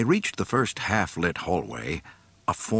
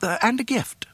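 fussy droid voice. Acted. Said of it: "Three P O added".

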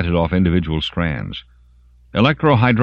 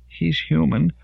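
pleading; 11 semitones higher than usual. A narrator's reading. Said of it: "Michelle implored".

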